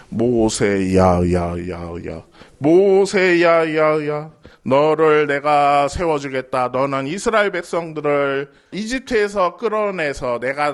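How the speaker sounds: deep, booming divine call; voice of God. Acted. God calling Moses from the burning bush, deep and commanding.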